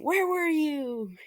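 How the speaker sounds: high, slow anxious mother voice; worried mom. Retold or imitated. Mother Inko questions son, high slow worry. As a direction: plead like a scared mother. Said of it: "Inko just saying".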